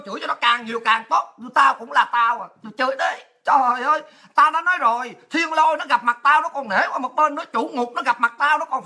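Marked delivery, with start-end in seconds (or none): none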